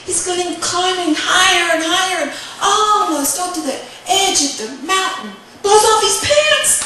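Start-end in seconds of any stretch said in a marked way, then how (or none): 5.6-6.8 high, loud comic exclamation; excited narration. A narrator's reading. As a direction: punch it up for the comic mishap. Storyteller exclaims at comic mishap.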